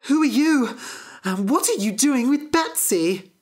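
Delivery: high, alarmed little harp voice. Acted. Magic harp confronts Jack, high and alarmed. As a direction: demand who he is, high and anxious.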